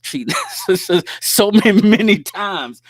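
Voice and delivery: high, loud, laughing through the words. Real, not acted. Ribbing a pal about getting caught cheating, laughing hard. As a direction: crack up and stammer through laughter, drawing it out. Teasing his old cheating self.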